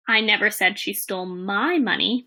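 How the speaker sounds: "my" lengthened and emphasized before "money"; stress on MY. Shown as a demonstration. Teacher shows it was another's money, stress on "my". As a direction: lean long on "my", contrasting whose it was. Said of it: "as different words are stressed".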